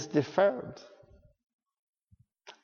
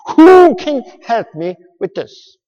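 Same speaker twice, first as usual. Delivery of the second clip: desperate cry; 7 semitones higher, 11 dB louder than usual. Pretend. Desperate plea voiced.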